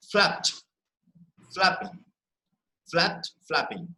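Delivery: flapped as one beat, flapping as "FLAP-ing"; weight on "flap" in flapped and flapping; stress on first part. Shown as a demonstration. Teacher repeats flapped, flapping with first part stressed. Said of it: "We are stressing on the first part".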